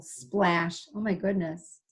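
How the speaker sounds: usual speaking voice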